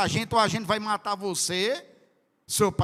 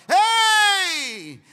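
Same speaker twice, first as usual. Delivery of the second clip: shout; 12 semitones higher, 9 dB louder than usual. Real, not acted. Preacher shouts at congregation.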